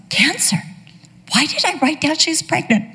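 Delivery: put-on high, baffled old mom; puzzled old mother. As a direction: wonder aloud like a confused mom.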